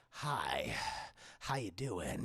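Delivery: put-on very low, softened drunk voice; drunk caller. Drunk caller greeting a woman, low and boozy. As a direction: imitate a drunk man on the phone. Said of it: "He sounds really drunk".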